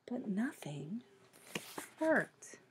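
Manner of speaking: whispered narration. A narrator's reading. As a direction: breathe it out as a hushed letdown. Story's sad turn.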